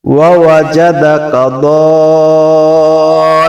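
দাল্লান held long like a recitation; four-beat madd. Shown as a demonstration. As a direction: sing দাল্লান with its vowel held four counts. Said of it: "চার আলিফ টেনে পড়তে হবে".

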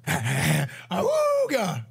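slow stretched-out cartoon horn call; cartoon awooga. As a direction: honk it out like an old cartoon. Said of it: "old school cartoon where".

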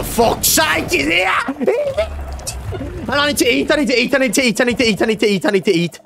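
high squeal into frantic repeated babble; shriek, then gabble in rising panic; high frantic fright, easing at the end; panicked. Real, not acted. Enemy appears, player flees.

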